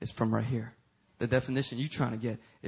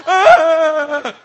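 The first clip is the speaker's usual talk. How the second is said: loud, high hammed-up scream; long haunted-house shriek, played for laughs; mock scared scream; 14 semitones higher, 19 dB louder than usual. Preacher imitates frightened visitor in haunted house, mock scream. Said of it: "they're crying because they're scared".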